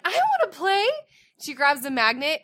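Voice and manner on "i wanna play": put-on high, loud little girl demand; little girl joining the table, high and loud. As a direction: chirp it like an eager three-year-old.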